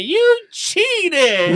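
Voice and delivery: put-on very high, loud child's accusing yell; kid yelling. Niece yelling at her cheating uncle, high mock yell. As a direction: shriek it like an outraged kid. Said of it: "I can hear her yelling behind me".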